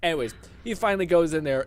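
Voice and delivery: normal talking voice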